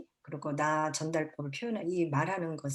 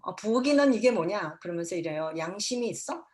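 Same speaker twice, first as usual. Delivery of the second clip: mother scolding over the messy kitchen, louder; raw irritated mom; 4 dB louder than usual. Shown as a demonstration. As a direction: let the raw annoyance spill out. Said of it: "날 감정이 그대로 나와요".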